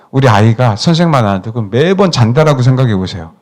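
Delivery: normal talking voice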